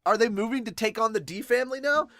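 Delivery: plain reading voice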